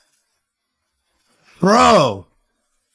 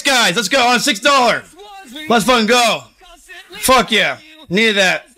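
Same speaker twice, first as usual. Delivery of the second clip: ordinary delivery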